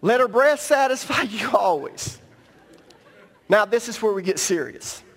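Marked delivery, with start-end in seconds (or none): 0.0-2.2 reading through a chuckle; laughing while reading. Real, not acted. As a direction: try to keep a straight face and fail. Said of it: "you all were laughing".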